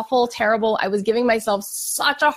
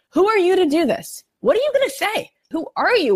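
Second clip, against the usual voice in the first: panicked inner voice; 9 semitones higher than usual. Retold or imitated. The nervous speaker replaying her racing doubts, panicked and high. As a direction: voice jumping much higher, frantic questions at herself. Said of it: "saying so loudly to myself".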